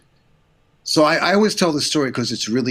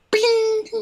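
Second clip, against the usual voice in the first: very high, sustained ringing "bing"; imitated bing. Storyteller showing his reaction to the boss's praise, imitated high bing. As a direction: sing out a high little chime. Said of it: "I went like this".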